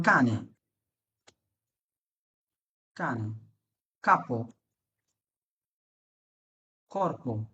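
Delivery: "কানে", "কাপো", "কর্প" each opened with hard k; hard k pronunciation. Shown as a demonstration. Teacher shows when c is k, "কানে", "কাপো", "কর্প" with hard k. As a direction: list "কানে", "কাপো", "কর্প" apart, low, with k sounds. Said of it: "কিন্তু ক হবে কখন জানেন".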